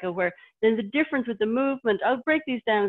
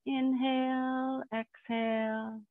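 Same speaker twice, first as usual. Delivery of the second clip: serene tai chi cues; 4 semitones higher, 6 dB quieter, much slower than usual. Shown as a demonstration. Tai chi instructor cueing the breath.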